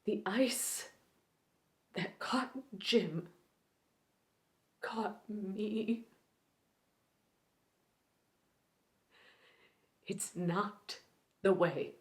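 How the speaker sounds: quiet, grieving recluse with long, halting pauses; Allie Mayo. Acted. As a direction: start hushed, let old grief push the pitch up, then sink back. Performs Allie Mayo's grieving monologue.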